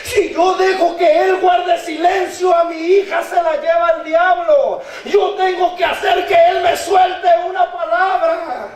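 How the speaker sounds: fervent, insistent preaching; prédica a viva voz. Real, not acted. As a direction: preach it out, voicing the mother's resolve. (predicación encendida)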